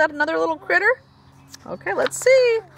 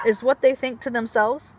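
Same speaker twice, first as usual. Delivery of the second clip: ordinary delivery, nothing marked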